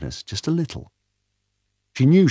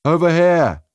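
calling out; 9 semitones higher, 6 dB louder than usual. Acted. Doctor calling from afar.

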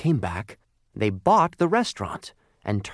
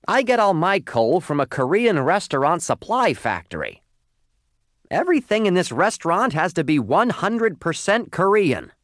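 loud, high-pitched indignant owner; scowling owner laying down the law; rude restaurant owner, indignant; 9 semitones higher, 5 dB louder than usual. Acted. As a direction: insist loudly, voice pitched right up.

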